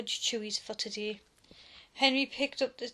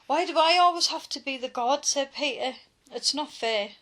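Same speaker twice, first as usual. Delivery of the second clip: higher, louder whiny complaint; whine; 4 semitones higher, 7 dB louder than usual. A narrator's reading. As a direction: sulk and protest. Peter whining about guard duty.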